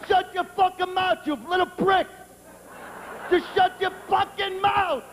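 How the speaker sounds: high-pitched, exasperated mock yell; hammed-up furious outburst at a mouthy kid; angry shut-up. Acted quarrel, man silencing the kid.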